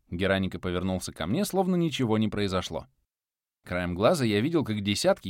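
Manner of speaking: plain reading voice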